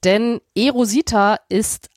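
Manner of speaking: ordinary talk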